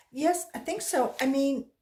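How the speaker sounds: put-on a flustered child stumbling to answer; nervous girl. A narrator's reading.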